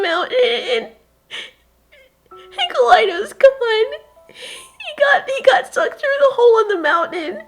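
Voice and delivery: put-on high, loud, crying child; sobbing account. Child telling her mother the brothers died, sobbing. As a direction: choke the story out through tears.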